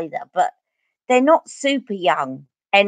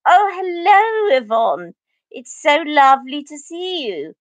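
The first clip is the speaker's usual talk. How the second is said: put-on high, drawn-out posh opera accent greeting; hammed-up posh accent, swooping high; 7 semitones higher than usual. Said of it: "I used to just put it on".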